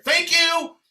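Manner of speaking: squeaky high, loud thank you; mock high thank you. To the record clerk, a squeaky high thank you. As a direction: pipe it up high and bright like a relieved kid.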